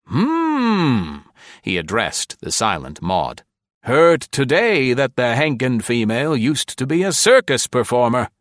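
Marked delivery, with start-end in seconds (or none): 0.1-1.2 high musing hmm. A narrator's reading. Caspier voiced. 3.8-8.3 jovial big man voice. A narrator's reading. Caspier voiced.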